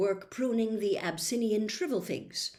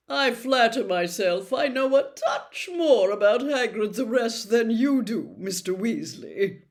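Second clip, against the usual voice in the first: loud, higher smug showman; self-satisfied; 4 semitones higher, 9 dB louder than usual. A narrator's reading. Lockhart smugly putting Ron in his place, loud. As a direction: savour your own superiority. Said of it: "in a self-satisfied tone".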